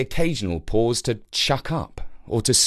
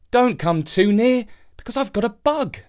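put-on higher young boy voice; 9 semitones higher than usual. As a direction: play the sick little boy. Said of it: "said Jake".

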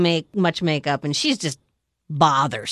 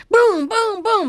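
gunshots; 10 semitones higher, 3 dB louder, slower than usual. Retold or imitated. Imitating gunshots.

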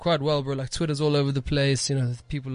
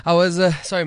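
ordinary talk, nothing marked

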